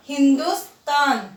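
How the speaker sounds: हिंदुस्तान slowly, plain त not थ; slow model pronunciation. Shown as a demonstration. Teacher correcting the थ sound in हिंदुस्तान. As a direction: say हिंदुस्तान slowly, hin-dus-tan, with plain t. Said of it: "पर इसका उच्चारण क्या होगा".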